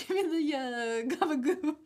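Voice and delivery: imitating a movie mobster asking for gabagoo; mock Italian American. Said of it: "where he's like".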